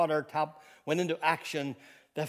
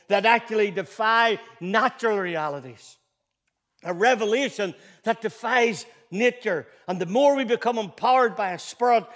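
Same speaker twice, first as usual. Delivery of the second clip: full-force preaching; 6 semitones higher, 7 dB louder than usual. Acted. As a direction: thunder it from the pulpit. Peak of preaching.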